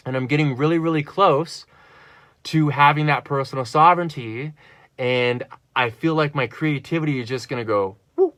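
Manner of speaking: ordinary delivery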